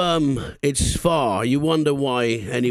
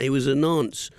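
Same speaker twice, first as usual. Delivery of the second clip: ordinary talk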